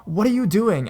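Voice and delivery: high, sharp angry shout; angry yell. Retold or imitated. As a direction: shout at the stranger touching your kid. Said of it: "my mom got up and yelled".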